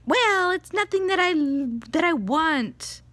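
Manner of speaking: high, loud mock whine; whiny kid. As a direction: imitate a kid whining in protest. Imitating daughter arguing back.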